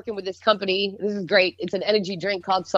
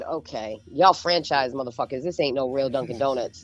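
disgusted scoff; 7 semitones lower, 3 dB quieter than usual. Retold or imitated. Retold disgust at bad coffee.

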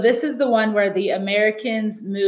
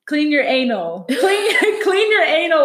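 laughing through quote; 6 semitones higher, 5 dB louder, slower than usual. Retold or imitated. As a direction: quote broken by bursts of laughter, louder. Retelling funny toilet scene.

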